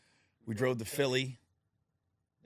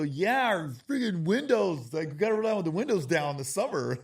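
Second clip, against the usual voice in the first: put-on high, louder kid voice complaining; whiny kids; 8 semitones higher, 8 dB louder than usual. Kids griping to their dad about grandma's car, whiny and high. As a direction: whine like his kids.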